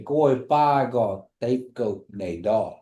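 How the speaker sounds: slow, carefully spaced Kiowa phrase; slow model phrase. Shown as a demonstration. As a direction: say "Koi pa go" very slowly, word by word. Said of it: "you could say it like that".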